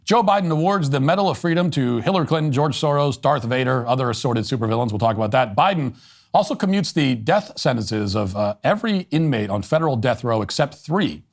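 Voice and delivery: ordinary delivery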